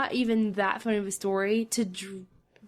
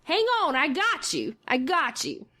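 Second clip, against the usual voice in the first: retold yell; 5 semitones higher than usual. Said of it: "I yell to this strange lady".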